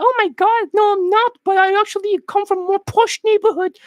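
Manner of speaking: high falsetto posh British woman; mock British accent. British woman denying a Hackney accent, high mock falsetto. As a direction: put on a piping British woman's voice. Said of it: "This is how British people talk".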